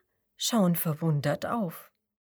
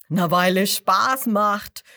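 loud rowdy bawl; loud rowdy crocodile bawl; 7 dB louder than usual. A narrator's reading. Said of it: "grölen die anderen Krokodile".

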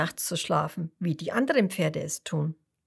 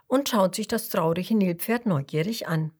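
usual speaking voice

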